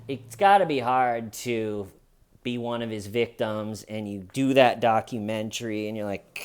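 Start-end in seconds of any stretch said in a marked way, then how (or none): none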